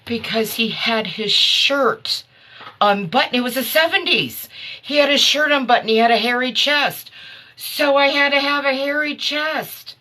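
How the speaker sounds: excited. Real, not acted. Host telling viewers about her [name] costume, giddy and loud. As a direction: gush about the seventies look.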